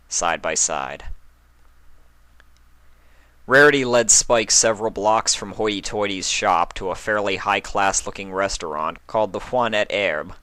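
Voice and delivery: ordinary delivery, nothing marked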